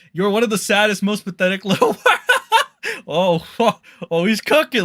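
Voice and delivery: loud, laughing read-out; laughing while reading. Real, not acted. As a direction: crack up mid-sentence. Reads insult, bursts laughing.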